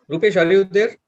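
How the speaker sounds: loud call across the room. Real, not acted. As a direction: raise your voice to call him. Calling out to a colleague.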